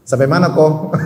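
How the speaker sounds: blunt, unfriendly ask; curt rough question. Shown as a demonstration. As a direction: toss it out bluntly, no softness. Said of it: "Kan beda".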